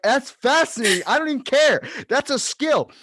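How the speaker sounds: high, loud, marvelling voice; amazed exclaim. Retold or imitated. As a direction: gush over it like a miracle. Amazed reply to grandmother.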